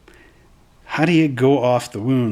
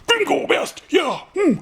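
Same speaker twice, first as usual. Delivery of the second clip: very high cartoon orc squeal; silly orc boast. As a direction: squeak it out proudly.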